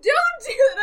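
high, loud scolding shriek; panicked yelling. Retold or imitated. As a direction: scream at a friend to stop. Retold screaming at frightened friend.

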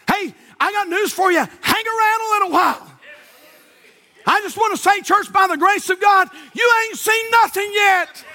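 triumphant shouted proclamation; preaching climax. Acted. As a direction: bring the sermon to its peak.

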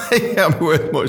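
laughing. Retold or imitated. Restart through laughter.

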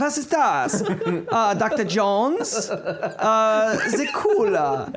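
slow, high hammed-up German accent. Rereading the quote for friends, hammy German accent. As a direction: lean hard into a comic German. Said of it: "read it again".

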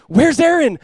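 high, loud panicked search call; alarmed calling out. Retold or imitated. Looking for someone maybe overboard, retold alarmed shout. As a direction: call out urgently for a missing man.

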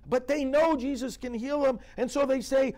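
loud, high, urgent preaching; impassioned preaching. Real, not acted. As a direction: drive the point home at full force. Full-force preaching, loud and high.

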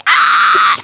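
airy, loud open-mouthed scream; mock roller-coaster scream. Showing a friend how she screamed in labour, breathy mock scream. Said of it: "y gritáis".

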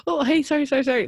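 mock apologetic Brit. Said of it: "they're just not".

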